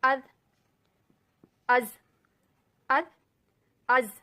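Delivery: अज with a hard, forceful z; सख्त अज; hard letter sound. Shown as a demonstration. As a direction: press अज out hard, again and again. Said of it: "आपको सख्ती महसूस हो रही होगी".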